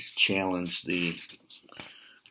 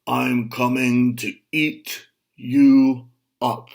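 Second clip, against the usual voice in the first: slow, louder growling troll threat; menacing troll; 3 semitones higher, 8 dB louder than usual. Acted. Troll threatens to eat the goat. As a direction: loom over the goat with it.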